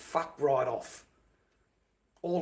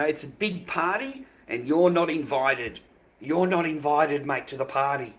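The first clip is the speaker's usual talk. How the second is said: louder, lightly voiced comedian punchline, not a real impression; George Callan quote; 3 semitones higher, 7 dB louder than usual. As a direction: punch it out like a comedian's line, only a hint of the voice. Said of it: "As George Callan used to say".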